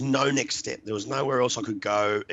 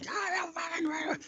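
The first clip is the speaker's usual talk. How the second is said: high, strained mock scream; imitated screaming; 6 dB quieter than usual. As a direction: scream it without full volume. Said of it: "as I'm screaming".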